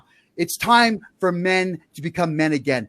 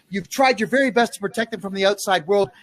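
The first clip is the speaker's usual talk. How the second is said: normal talking voice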